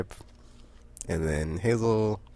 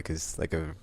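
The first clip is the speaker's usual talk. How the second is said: ordinary delivery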